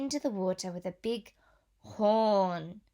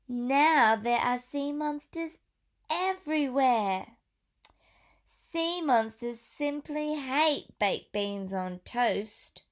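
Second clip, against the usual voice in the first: put-on reading to children; 4 semitones higher, 5 dB louder than usual. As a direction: clear storybook read-aloud voice. Reads picture book to children.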